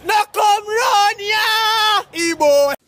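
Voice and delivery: slow terrified wailing shout; panicked screaming. Acted. Yelling in terror at someone to stay away from the Ebola. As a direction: scream it out in panic like doom is coming.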